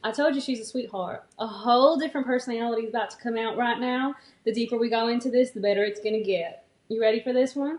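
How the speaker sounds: country sweetheart persona; country accent. Pretend. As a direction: talk like a country gal with personality. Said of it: "The country version of me".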